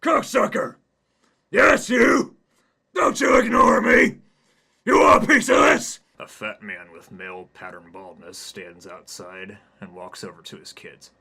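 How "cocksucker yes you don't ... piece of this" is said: very high, very loud bellowing; loud angry yell. Acted. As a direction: scream at him across the yard. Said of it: "a loud voice".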